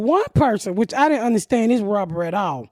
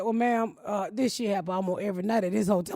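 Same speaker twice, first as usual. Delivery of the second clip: softer, offhand officer voice; mock flat cop voice; 4 semitones lower, 9 dB quieter than usual. The officer telling her break-ins happen nightly, offhand and soft. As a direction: shrug it off like a bored cop.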